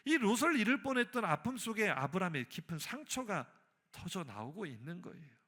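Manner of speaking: ordinary talk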